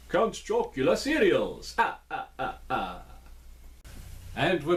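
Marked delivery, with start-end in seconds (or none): 0.1-1.7 hammed-up Transylvanian accent, pitched higher. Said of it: "my Transylvanian accent". 1.8-3.0 vampire count laugh. Pretend. Count's laugh after brand name.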